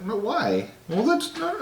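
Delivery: high imitation of the confused wife. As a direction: ask it high like the puzzled wife. Wife voiced.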